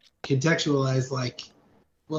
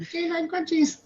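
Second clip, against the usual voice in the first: squeaky puppy voice. Retold or imitated. Quoting a puppy line.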